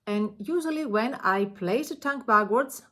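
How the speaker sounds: usual speaking voice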